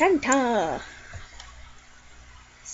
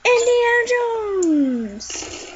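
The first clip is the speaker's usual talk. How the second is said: drawn-out announcement; 8 semitones higher, much slower than usual. Acted. Announcing a character.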